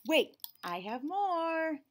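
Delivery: sudden gasp, then high and drawn out; gasp of surprise. A narrator's reading. Child eager to add more.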